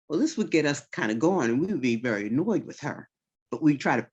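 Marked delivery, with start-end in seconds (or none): none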